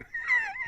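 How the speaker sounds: high, soft wheezing laugh; breathless high giggle; wheezy laugh. Real, not acted. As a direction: crack up in a thin wheeze. Laughing at own Boston joke.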